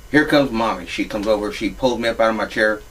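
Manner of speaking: ordinary talk, nothing marked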